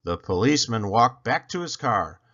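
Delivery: plain reading voice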